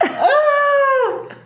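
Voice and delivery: high, loud mock wail. Newcomer wailing at the old-timers' sobriety, high and loud. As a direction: let out a big high comic wail.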